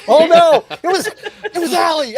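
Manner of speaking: high laughing exclamation. Real, not acted. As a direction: laugh through the words. Laughing mock realization.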